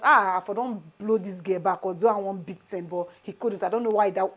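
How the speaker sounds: usual speaking voice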